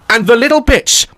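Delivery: ordinary talk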